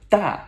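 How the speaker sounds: DA high and loud with a steep fall; sharp descending tone. Shown as a demonstration. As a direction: hit "Da" like a sharp no. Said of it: "That sharp no".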